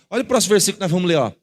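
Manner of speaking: ordinary talk, nothing marked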